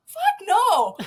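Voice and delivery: high, loud emphatic refusal; retold yell back. Two shocked keepers answering the vet, high loud yell. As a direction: holler it back across a distance. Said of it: "So we just yelled back at her".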